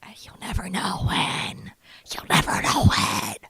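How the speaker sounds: raspy, unvoiced villain threat; creepy stage-whisper swelling into a harsh growl; mock menace. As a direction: rasp it out slowly, building to loud. Mock stalker menace, rasping.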